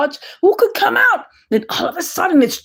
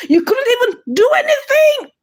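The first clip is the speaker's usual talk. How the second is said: exasperated outburst; 11 semitones higher than usual. Real, not acted. Ranting at the character on screen.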